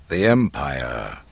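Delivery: low, slow and firm; firm declaration. A narrator's reading. Ben Kenobi coming in behind Luke, firm declaration. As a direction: state it firmly, pitched low. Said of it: "a voice declared firmly".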